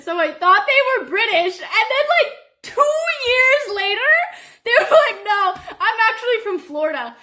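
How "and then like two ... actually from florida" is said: laughing hard. Real, not acted. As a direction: laugh almost crying at the memory. Laughing disbelief story.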